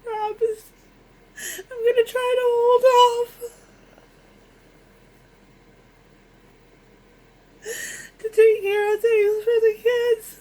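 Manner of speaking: sobbing through the words, high and loud; real, raw, breaking down in tears; ugly crying. Real, not acted. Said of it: "Sorry for the ugly crying".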